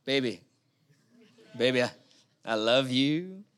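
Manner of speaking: tender to wife. Retold or imitated. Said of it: "If I say to my wife".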